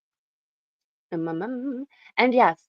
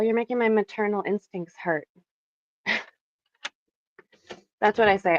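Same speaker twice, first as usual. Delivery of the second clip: ordinary talk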